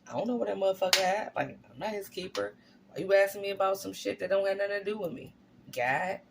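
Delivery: fast, dismissive street talk; mock defiant Cain. Cain talking back to God, fast and dismissive. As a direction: brush God off, fast and careless. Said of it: "he was like".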